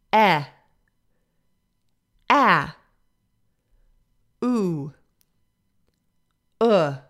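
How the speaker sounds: each vowel held alone for repeating; isolated vowel sounds. Shown as a demonstration. Teacher models vowels for students to repeat, clearly. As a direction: model each vowel cleanly, pausing between. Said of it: "repeating after me by just repeating the sound".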